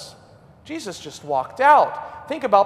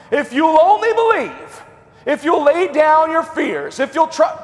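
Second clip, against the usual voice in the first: mock rousing sermon; 8 semitones higher, 6 dB louder than usual.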